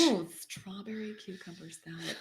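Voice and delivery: sassy drag queen read. Pretend. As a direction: savor each word like a diva. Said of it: "feeling the need to say this in the drag queen voice".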